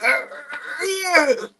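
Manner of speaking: mock breathless cry; out of breath. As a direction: wheeze it out like you can't get air. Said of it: "I'm like".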